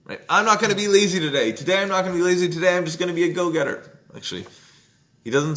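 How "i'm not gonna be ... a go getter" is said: pumped-up resolve. Retold or imitated. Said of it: "Don't try to stop being lazy".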